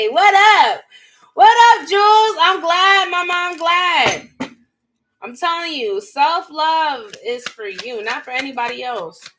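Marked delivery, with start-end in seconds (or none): 0.1-4.3 high, loud hype shout-out; loud happy call to viewers; excited greeting. Real, not acted.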